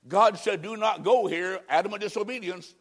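ordinary talk

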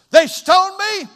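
high, loud, drawn-out mock jubilation; exultant cry. Preacher voices the stoned apostle, exultant high cry. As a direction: crow it out loud and slow.

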